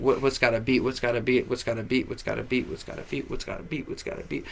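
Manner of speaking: fading to a whisper; thinking to himself. Retold or imitated. Searching his mind for a beat, fading to a whisper. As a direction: mutter it over and over, fading out. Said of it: "I'm thinking".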